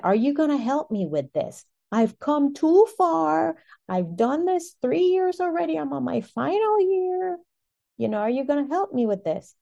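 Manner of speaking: pleading prayer. Retold or imitated. Speaker on the floor begging God to help her finish, pleading high. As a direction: beg God for help, voice rising.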